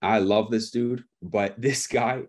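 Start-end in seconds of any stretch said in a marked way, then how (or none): none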